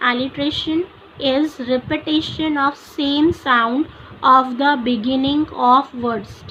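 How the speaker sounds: very slow, word by word for notes; slow dictation. Shown as a demonstration. Teacher dictates definition to students, very slow. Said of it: "तो what is alliteration?".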